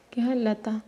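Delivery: normal talking voice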